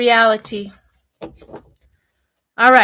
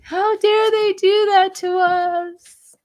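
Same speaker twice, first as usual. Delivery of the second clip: mock outrage; 8 semitones higher, slower than usual. Mocking Trump family outrage.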